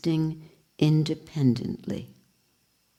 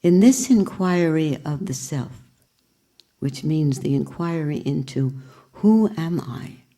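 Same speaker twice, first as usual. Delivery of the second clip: ordinary delivery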